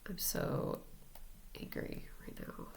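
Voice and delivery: hushed whisper of the anger phrase; whispered mantra. Shown as a demonstration. Tapping the chin, muttering anger to herself in a whisper. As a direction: whisper it so nobody nearby hears.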